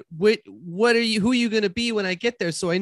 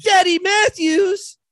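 high, drawn-out incredulous cry; stunned, pitched way up; astonished; 13 semitones higher, 4 dB louder, slower than usual. Acted. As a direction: stretch it out in shocked disbelief. Stunned by who dad is.

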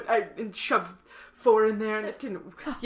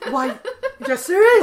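excited; 8 semitones higher, 10 dB louder than usual. Acted. Delighted answer, olde phrasing.

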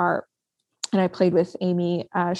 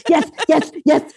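high, punchy repeated cheers; excited cheering; 8 semitones higher, 4 dB louder than usual. Retold or imitated. Guest acting out watching Logan in the living room, excited cheer. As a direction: re-enact hyped-up cheering. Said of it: "in my living room going".

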